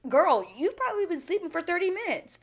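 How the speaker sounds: loud, high-pitched scolding; exasperated retort. Retold or imitated. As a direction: exclaim it in exasperated disbelief. Exasperated comeback to sleeper.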